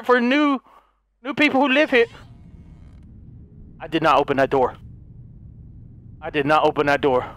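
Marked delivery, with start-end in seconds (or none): 3.8-4.8 low, steady insistence; low mutter. Real, not acted. Gamer denying opening the door, voice lowered. Quiet deep repetition. 6.2-7.3 low, steady insistence; low mutter. Real, not acted. Gamer denying opening the door, voice lowered.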